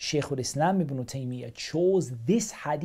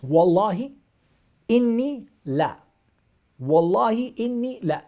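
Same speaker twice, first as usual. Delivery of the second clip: louder and higher on Wallahi and إني لا; stressing the oath words; 7 semitones higher, 6 dB louder than usual. Shown as a demonstration. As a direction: hammer each oath word out. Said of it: "Three emphasis".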